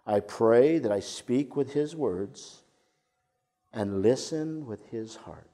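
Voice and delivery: each word laid down slowly; slow it right down so every word lands; a slow, measured prayer to soften an argument; slow, deliberate prayer line. Shown as a demonstration. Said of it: "Do you hear that?".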